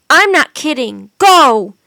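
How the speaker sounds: loud final shout. A narrator's reading. Jack's last warning to the wolves, loud shout. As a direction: make them believe you. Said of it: "shouted Jack".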